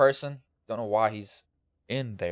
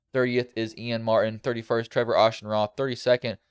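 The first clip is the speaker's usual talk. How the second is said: ordinary talk, nothing marked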